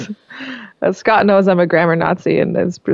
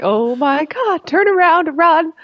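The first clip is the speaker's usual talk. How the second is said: higher, slow then rushed pretend fright; mock panic; 9 semitones higher, slower than usual. Wife fleeing the husband's death stare, mock panic. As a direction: play a scared wife backing away. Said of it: "She's probably like".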